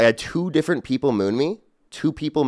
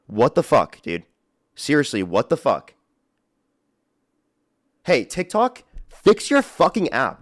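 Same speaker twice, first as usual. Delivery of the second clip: furious at TikTok; 3 semitones higher, 3 dB louder, slower than usual. Real, not acted. Streamer yelling at TikTok over trolls, furious. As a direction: drag it out in real outrage.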